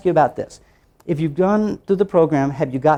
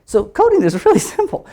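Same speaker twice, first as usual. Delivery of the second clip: high-pitched, breaking into a laugh; 12 semitones higher, 4 dB louder than usual. Real, not acted. Teacher saying coding is simple, laughing as she speaks. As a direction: laugh through the words, pitched up.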